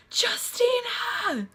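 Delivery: high, drawn-out cry for help; imitated scream. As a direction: scream the name like someone crying for help. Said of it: "screamed. Like somebody was, like, crying for help".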